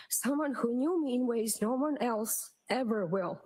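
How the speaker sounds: usual speaking voice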